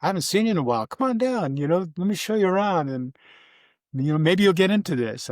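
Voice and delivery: lightly put-on brother, coaxing him down; imitating his brother. Brother Kim invites him to the Gulf, high and eager. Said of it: "He said".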